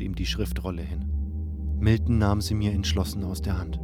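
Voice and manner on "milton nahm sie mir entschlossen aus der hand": ordinary delivery, nothing marked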